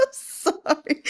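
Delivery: breaking into high giggles; real laughing fit. Real, not acted. The narrator breaking character, giggling and apologizing. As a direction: crack up mid reading.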